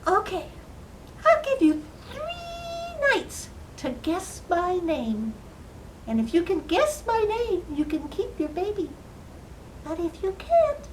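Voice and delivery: high-pitched little man voice; bargaining little man. Acted. Little man offers queen three nights to guess, high-pitched. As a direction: lay down terms in a high, teasing squeak.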